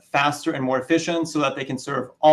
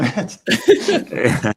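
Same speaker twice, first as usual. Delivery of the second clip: laughing; 7 semitones higher, 4 dB louder than usual. Real, not acted. Laughing after the boss joke.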